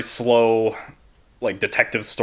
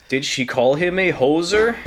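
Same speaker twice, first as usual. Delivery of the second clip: higher, louder jokey Canadian; mock Canadian accent; 7 semitones higher, 4 dB louder than usual. Teasing his cohost about her accent, mock Canadian. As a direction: lean into the Canadian slang. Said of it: "Or did she talk like this".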